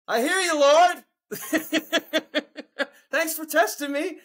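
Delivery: high, laughing mock prayer to the Lord. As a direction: look up and thank God sarcastically, laughing.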